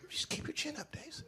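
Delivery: breathy whisper of comfort; whispered advice. Retold or imitated. Advice giver whispering to Dave. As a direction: whisper it like soothing a friend. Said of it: "So they be saying stupid".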